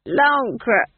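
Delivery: high, loud tipsy blurt; drunk fool. A narrator's reading. Fool supplying his home's name to the bar, loud and drunk. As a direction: blurt it out boozily.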